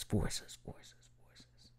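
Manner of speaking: fading echo effect; whispered echo. Pretend. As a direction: repeat it fading out.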